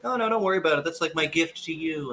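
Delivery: high, easy brush-off; imitating the boss. Boss waves off payment for the gift, imitated. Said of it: "And he's like".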